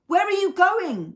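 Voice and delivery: loud, high, slow shout; yelled. A narrator's reading. Fez yelling after BB-8 speeding away, loud. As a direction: yell after him in alarm. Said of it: "yelled Fez".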